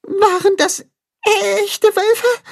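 very high, slow, tearful quaver; trembling with fear. A narrator's reading. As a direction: quiver like a scared little creature. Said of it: "bibbert Tacho".

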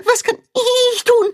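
very high, whiny repeat; high calf voice pressing on tun; begging little calf. A narrator's reading. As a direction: beg again, stressing tun. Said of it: "bettelt Tacho".

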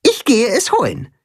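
viel höhere, verschmitzte Hühnerstimme; augenzwinkernd. Acted. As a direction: mit einem Zwinkern den Plan verkünden. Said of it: "zwinkert Tim Max zu". (Timme zwinkert Max zu, hoch und verschmitzt)

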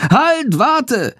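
a mild high call to stop him. Acted. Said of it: "ruft Chandor".

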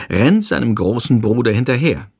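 ordinary delivery, nothing marked

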